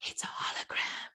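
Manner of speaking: voiceless, very soft "It's a hologram."; small secret voice whispering "It's a hologram."; tiny whisper. Retold or imitated. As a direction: lean in and breathe "It's a hologram.". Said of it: "this little tiny voice leaned in and went".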